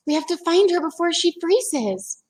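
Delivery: put-on high, worried girl voice; urgent Annie. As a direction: plead to hurry before the mouse freezes. Annie voiced in read-aloud.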